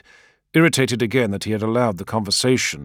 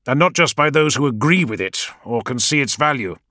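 higher and louder, pressing hard; frustrated insistence; 9 semitones higher, 6 dB louder than usual. A narrator's reading. War leader venting to his adviser about his orders, frustrated and loud. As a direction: drive the point home, exasperated.

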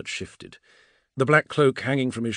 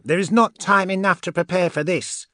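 high, loud, urgent character voice; heated witch's rebuttal; vehement retort; 12 semitones higher, 9 dB louder than usual. Acted. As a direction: cut back in forcefully, pitched high. Andromeda argues heatedly back.

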